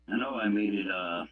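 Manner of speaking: ordinary talk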